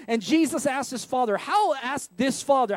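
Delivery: ordinary talk, nothing marked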